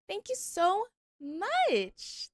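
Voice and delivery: high, slow, sweet thank-you; sweet drawn-out thanks. Real, not acted. Thanking chat for a gift.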